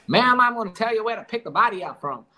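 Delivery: put-on high-pitched American-Korean cowboy accent. Captor phones mother about the body, high Korean cowboy accent. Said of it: "Korean cowboy accent".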